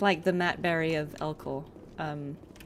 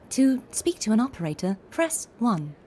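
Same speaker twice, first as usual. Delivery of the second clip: higher, bright recorded phone-menu voice; automated phone menu; 5 semitones higher than usual. Shown as a demonstration. A voice actor demoing a phone menu, pitched up and bright. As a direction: sound like the phone system reading options. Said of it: "that kind of voice is not a character voice".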